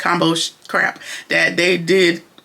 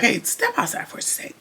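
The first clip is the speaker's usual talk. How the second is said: urgent whisper, pulling someone aside; Fatima pulling Hayden aside, whispered; 5 semitones higher, 7 dB quieter than usual. Retold or imitated. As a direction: hiss it quietly so others won't hear.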